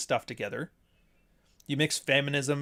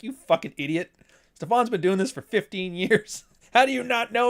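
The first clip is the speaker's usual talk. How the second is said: mocking crowd; 8 semitones higher, 4 dB louder than usual. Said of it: "everybody's like".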